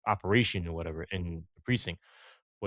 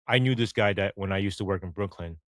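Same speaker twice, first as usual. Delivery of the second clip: ordinary talk, nothing marked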